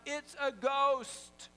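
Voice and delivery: high, loud, drawn-out cry of fear; hammed-up frightened outcry; fearful cry. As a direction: cry it out slowly, acting terrified. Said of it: "In their fear, they cried out".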